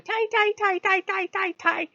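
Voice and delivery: high mock chanting; Lou. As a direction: rattle off high nonsense syllables from the sidelines. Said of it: "Lou's in the background going".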